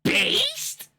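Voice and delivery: very high-pitched questioning squeal; squealed surprise. Acted. Streamer reacting to co-host's line, high squealed surprise. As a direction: shoot way up into a squeak.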